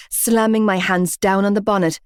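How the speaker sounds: ordinary delivery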